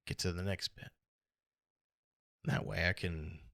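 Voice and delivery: ordinary delivery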